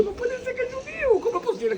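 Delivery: very high squeak of shock; astonished disbelief. Retold or imitated. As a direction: gasp it in total disbelief. (asombro incrédulo con voz muy aguda)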